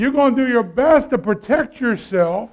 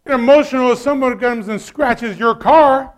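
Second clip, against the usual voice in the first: ordinary delivery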